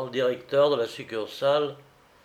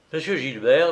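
ordinary delivery, nothing marked

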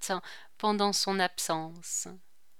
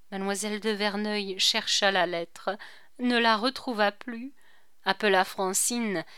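plain reading voice

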